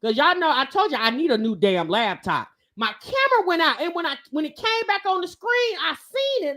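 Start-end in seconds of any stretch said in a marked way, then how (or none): none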